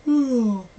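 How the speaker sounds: loud, high, falling sigh of boredom; hammed-up groan of boredom; exaggerated bored sigh. Shown as a demonstration. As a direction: heave a big bored sigh. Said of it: "That's how boring it is".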